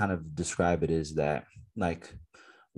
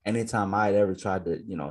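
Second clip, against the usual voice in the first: normal talking voice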